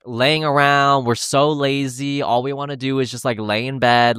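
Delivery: ordinary talk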